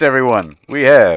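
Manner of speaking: loud, high-pitched proclamation; excited announcement. Acted. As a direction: announce it big to the whole table. Game master announces spell to table.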